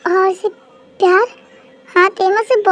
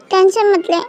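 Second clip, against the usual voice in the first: usual speaking voice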